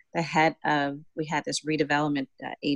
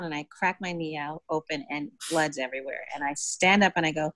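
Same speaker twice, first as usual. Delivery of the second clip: normal talking voice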